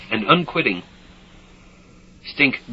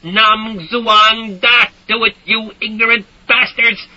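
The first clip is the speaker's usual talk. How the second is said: furious screaming; 10 semitones higher, 5 dB louder, slower than usual. A narrator's reading. Screaming while firing.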